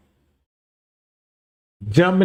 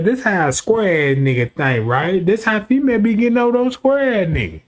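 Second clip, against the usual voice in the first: ordinary delivery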